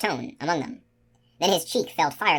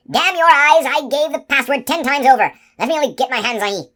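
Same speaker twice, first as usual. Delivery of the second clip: furious, much higher and louder roar; yelling with rage; 10 semitones higher, 8 dB louder than usual. A narrator's reading. Wounded giant roaring at the sentry, furious yell. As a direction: bellow in rage at the unseen archer. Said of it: "Jack Jingley yelled with rage".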